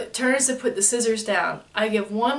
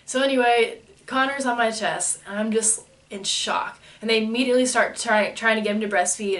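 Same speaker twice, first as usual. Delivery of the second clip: usual speaking voice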